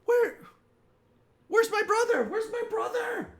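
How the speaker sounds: very high, loud frantic calling; panicked sister. Acted. Sister searching the crowd for her brother, frantic and high. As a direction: call out high and desperate.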